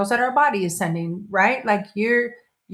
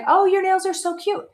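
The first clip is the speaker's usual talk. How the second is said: gushing compliment; 11 semitones higher than usual. Retold or imitated. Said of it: "I'd be like".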